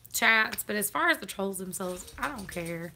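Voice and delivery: ordinary delivery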